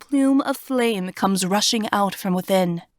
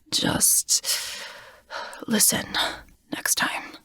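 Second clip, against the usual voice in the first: faint, breathy whisper; weak whisper; 5 dB quieter, slower than usual. Acted. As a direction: whisper it, exhausted. Wounded Smock murmurs weakly.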